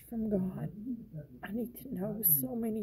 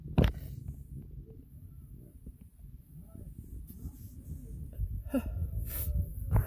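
a long, drawn-out tired sigh; long weary sigh; 5 semitones lower than usual. Real, not acted. A worn-out believer sighing about unanswered prayers, long weary sigh. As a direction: breathe out all the weariness.

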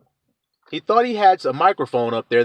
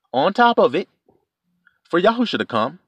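ordinary delivery

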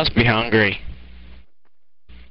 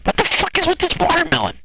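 freaked-out squeal; much faster than usual. Acted. Freaked out by following watermelon.

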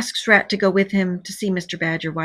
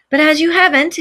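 high, loud impatient interruption; interrupting; 9 semitones higher, 6 dB louder than usual. A narrator's reading. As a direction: cut in loudly, pitched well up. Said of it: "interrupted the Rat".

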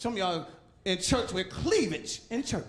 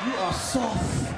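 mock tender words to a fluffy puppy; 3 semitones lower, much slower than usual. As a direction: coo it to the puppy, slowly. Tender to puppy used as wipe.

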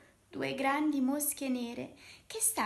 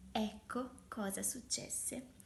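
usual speaking voice

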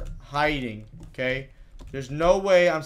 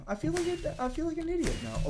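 high, quieter squeaky whine; embarrassed whine; 14 semitones higher, 5 dB quieter, faster than usual. Real, not acted. Gamer feeling dumb after a failed jump, high whine. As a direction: whimper it high, deflated.

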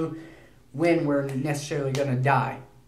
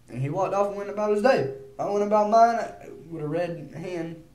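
ordinary talk, nothing marked

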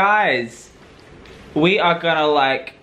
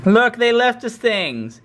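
excited; 9 semitones higher, slower than usual. Real, not acted. Discovering gifts in room.

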